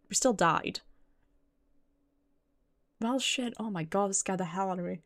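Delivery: ordinary talk, nothing marked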